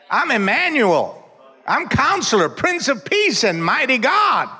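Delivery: building from high to shouting very high; preacher building to a peak on the names of God, shouting; preaching crescendo. Acted. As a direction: climb with each name until it peaks. Climbing list of names.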